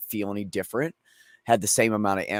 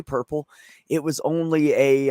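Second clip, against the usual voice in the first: ordinary talk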